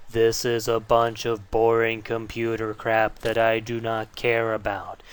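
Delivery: slow droning imitation of a lecturer; mock boring lecture. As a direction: drag it out like an endless lecture. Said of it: "listen to you talk for hour after hour".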